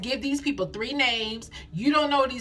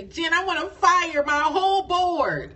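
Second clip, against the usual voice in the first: higher, slower mock plea; a caller pleading to fire the board, higher and slower; imitating a frustrated caller; 8 semitones higher, 4 dB louder than usual. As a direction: play the fed-up client, pitched up and drawn out. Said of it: "I have had people call me and say".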